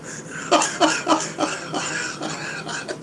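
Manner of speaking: high, soft wheezy laugh; long breathless giggling fit; laughter. Real, not acted. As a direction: crack up helplessly, high and airy. Cracks up at jail joke.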